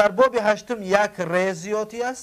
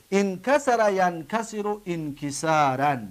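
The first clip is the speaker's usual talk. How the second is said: انکسر ینکسر انکسارا drawn out slowly; slow recitation; slower than usual. Shown as a demonstration. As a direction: model the paradigm slowly. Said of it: "ببینید". (آهسته و کشیده)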